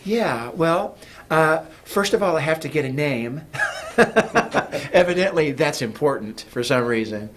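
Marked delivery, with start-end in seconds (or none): 3.5-4.9 breathy burst of laughter; real laugh. Real, not acted. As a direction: laugh it off lightly. Laughs about needing a name.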